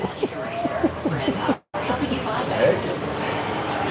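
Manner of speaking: high breathless airless laughter; silent wheeze laugh. Real, not acted. Streamer losing it after his joke, wheezing laugh.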